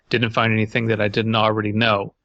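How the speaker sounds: ordinary delivery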